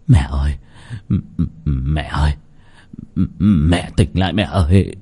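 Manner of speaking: low, stammering horrified plea; a son's frightened, halting cry, pitched low. A narrator's reading. Son trying to wake his motionless mother, horrified. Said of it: "Lão Tính kinh hãi".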